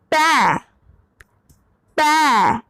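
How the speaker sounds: पै with an open ai and a slight ya glide at the end; पै ends with a faint ya sound; pron demo. Shown as a demonstration. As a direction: let पै trail into a light ya. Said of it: "थोड़ा या रह रहा हो".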